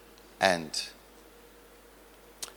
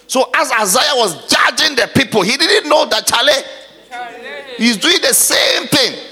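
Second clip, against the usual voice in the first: loud, ringing sermon delivery; full-force preaching; 6 semitones higher, 5 dB louder than usual. Acted. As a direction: preach it out hard to the congregation.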